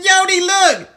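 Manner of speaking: high, loud excited holler; urgent call. Acted. Buttercup calling Yodi to look, high urgent shout. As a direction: shout to get a friend's attention.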